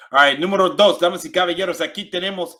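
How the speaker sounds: high, loud host voice; loud game-show countdown; countdown announcer. Acted.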